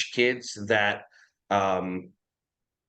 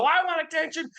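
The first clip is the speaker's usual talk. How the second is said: put-on child's voice; faster than usual.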